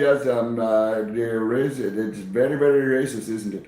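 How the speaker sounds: put-on Indian accent; hesitant answer in mock Indian accent; polite stage Indian-accented English. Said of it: "if you do your Indian accent".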